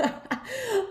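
high, teary little laugh; emotional laugh. Real, not acted. Moved by her own story, a teary high laugh. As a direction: let a choked laugh slip out. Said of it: "getting emotional".

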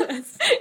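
sudden high-pitched laugh burst; high squeal of laughter. Real, not acted. Host cracks up at her cohost's rehab joke, squealing laugh. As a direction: let a squeaky laugh escape.

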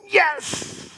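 a hammed-up inner cheer; secret gloat. Pastor imitating inner delight at someone's downfall, mock cheer. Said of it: "inside you're going".

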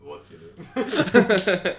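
mock announcer. Playful loud drawn-out question.

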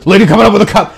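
hammed-up radio warning; urgent radio call. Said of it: "this was a radio time of".

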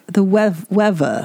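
"weather" with no final R, like "wevva"; R dropped. Shown as a demonstration. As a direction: say "weather" British-style, TH as V, R dropped. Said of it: "they don't do their R's".

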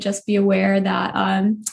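ordinary talk, nothing marked

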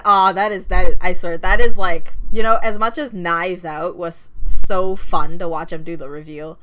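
loud, eager rave about a favorite scene; can't contain the excitement; excited gushing. Real, not acted. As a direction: burst out with real enthusiasm.

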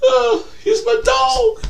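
slow, high weepy squeal; hammed-up tearful falsetto; Terrell Owens. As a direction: blubber it in mock heartbreak. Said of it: "Terrell Owens".

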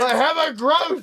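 very high, loud, slow cry; over-the-top acted shout; hammed-up outburst. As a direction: belt the line out in a silly high voice. Repeats acted line over applause.

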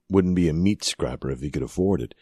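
usual speaking voice

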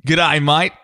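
Australian accent, rising g'day. Shown as a demonstration. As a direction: greet like an Aussie with an Australian accent. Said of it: "You wanna hear it?".

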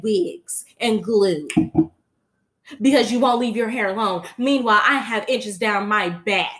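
put-on loud, high sassy child diva. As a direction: show off to the grown-ups, loud and sassy. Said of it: "Blue said".

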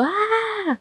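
high, soft imitation scream; mock scream. Shown as a demonstration. Therapist demonstrating someone screaming, held-back mock scream. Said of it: "una persona gritando".

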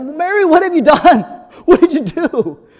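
hammed-up frantic sister; alarmed Martha. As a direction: sister shrieking what have you done, half laughing. Sister Martha panicking at Mary.

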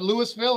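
Louisville said as LOO-us-vil; sounded-S Louisville. Shown as a demonstration. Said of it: "How do you say it?".

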